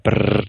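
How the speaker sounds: rolled R buzz like a toy gun; machine gun trill. Shown as a demonstration. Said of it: "the machine gun noise that a lot of kids make".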